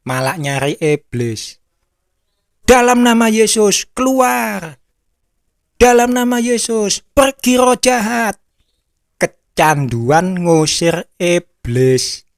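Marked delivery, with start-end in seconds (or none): 2.6-8.4 high, commanding exorcism cry; mock exorcist. Exorcist commanding the demon to leave, high and forceful. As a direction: cast the demon out like a showy exorcist.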